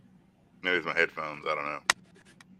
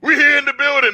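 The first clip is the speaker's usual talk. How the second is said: mock hype interruption; 12 semitones higher, 5 dB louder than usual. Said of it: "And it's like".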